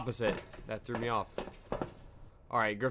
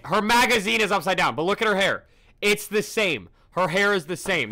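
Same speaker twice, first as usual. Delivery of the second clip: excited; 6 semitones higher, 7 dB louder than usual. Real, not acted. Spotting the anomaly.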